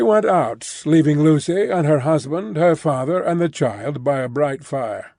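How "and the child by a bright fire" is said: usual speaking voice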